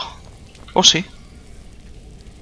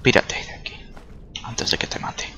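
menacing whisper; 9 dB quieter than usual. Pretend. Threatening freed prisoner in game.

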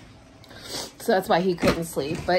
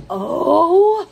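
high, loud groan of an oh; loud exasperated oh at the cat; 9 semitones higher, 8 dB louder than usual. Retold or imitated. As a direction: let out a fed-up oh. Retold reaction to cat.

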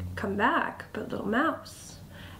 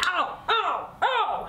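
high, falling "ow" cries; sharp storybook ouches; acted yelps of pain; 8 semitones higher, slower than usual. A narrator's reading. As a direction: yelp as if stung repeatedly. Weasel stung by bees.

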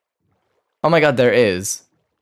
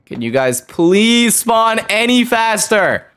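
high, drawn-out exasperated whine; exasperated pleading; 7 semitones higher, slower than usual. Real, not acted. Gamer begging enemies to spawn faster, exasperated pleading. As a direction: beg them, stretching the words in frustration.

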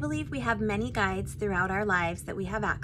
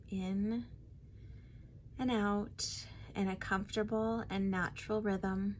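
guided meditation; 5 dB quieter than usual. Acted. Guide leading listeners to meet their spirit guide, guided meditation. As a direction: ease them inward, phrase by phrase, unhurried.

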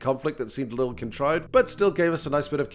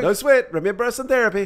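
high chirpy friend voice; lightly voiced friend; 8 semitones higher, 6 dB louder than usual. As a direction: toss it off brightly, higher. Voicing crew's reply.

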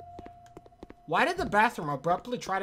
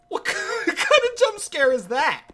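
high, slow laugh-through question; 11 semitones higher, 5 dB louder, slower than usual. Real, not acted. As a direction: crack up mid-sentence at the scare. Laughing at jump scare.

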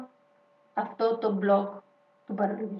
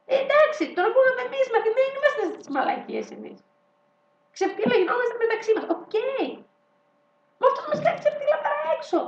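higher, mocking rant; raised mock voice; 12 semitones higher, faster than usual. Vlogger mocking politicians in a high voice.

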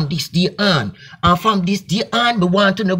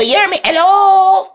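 high, louder call to the crowd; 11 semitones higher, 5 dB louder than usual. Acted. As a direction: demand they listen. Calling out to audience.

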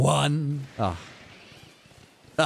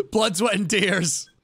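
dramatic high declaration; 9 semitones higher than usual. Acted.

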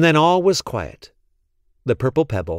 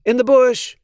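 higher and louder, calling the lizard; higher, louder urgent call; calling out quickly; 11 semitones higher, 4 dB louder than usual. A narrator's reading. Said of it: "he called quickly".

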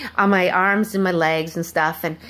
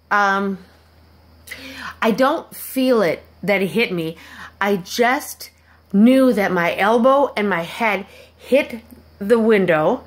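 ordinary talk, nothing marked

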